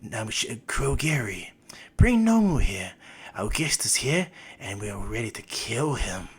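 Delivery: villain voice, sliding into near-whisper; Shigaraki. Retold or imitated. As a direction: give orders as a creepy villain. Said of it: "Shigaraki goes".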